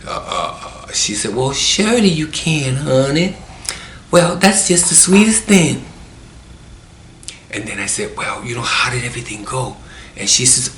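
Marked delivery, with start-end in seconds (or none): 1.3-5.8 high, put-on honeyed nurse voice; drawn-in, sugary high voice of a kindly nurse; sweet nurse. Voicing the nurse's sweet reply. 8.2-9.8 hushed, quick question, still voiced; lightly imitated hushed voice; retold whisper. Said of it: "you don't have to whisper nothing".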